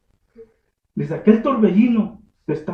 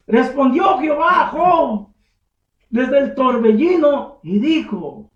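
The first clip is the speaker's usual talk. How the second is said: slow, raised scripture reading; preaching read; 6 semitones higher, 9 dB louder, slower than usual. Acted. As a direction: proclaim the verse slowly.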